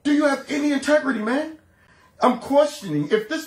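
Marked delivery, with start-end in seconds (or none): 0.0-1.6 incredulous. Retold or imitated. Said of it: "I'm like".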